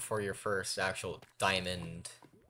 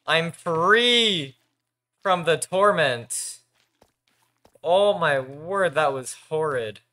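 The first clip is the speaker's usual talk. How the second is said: relieved; 6 semitones higher, 9 dB louder, slower than usual. Real, not acted. Relief after escape.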